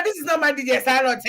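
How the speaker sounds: ordinary delivery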